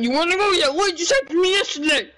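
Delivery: high, quick mock challenge; playing his friend. Friend re-enacting the row with Alfie, mock confrontational. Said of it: "No, no, you're Alfie".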